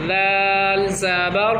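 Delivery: each letter stretched very slowly for the pupil; drawn-out spelling. Shown as a demonstration. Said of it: "اب ہم اس پہلے والے حرف کی رواں کریں گے".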